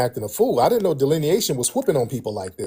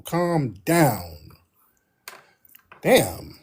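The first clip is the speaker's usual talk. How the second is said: ordinary talk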